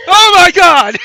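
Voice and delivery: high shrieking, laughing panic; panicked scream with laughter. Acted. Panicking wounded man.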